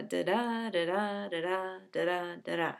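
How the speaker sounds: da-DA, da-DA, five times with the second syllable stressed; iambic beat. Shown as a demonstration. Teacher voicing the poem's underlying rhythm, iambic beat. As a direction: tap out each "da-da" weak then strong. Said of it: "chosen for the stress of their syllables".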